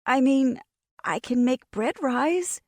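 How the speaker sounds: nervous. A narrator's reading. Nervous defence.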